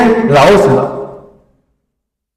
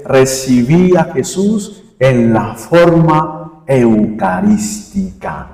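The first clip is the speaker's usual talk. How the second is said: slow, measured, elevated diction; lofty churchgoer, carefully spaced words; erudite speaker; 4 semitones lower, 3 dB quieter, slower than usual. Acted. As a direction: savor the learned phrase slowly, sinking low at the end. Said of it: "los que son más eruditos en hablar".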